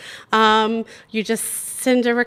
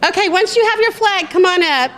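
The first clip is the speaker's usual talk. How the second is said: calling out; 8 semitones higher, 4 dB louder than usual. Real, not acted. Calling crowd up for photo.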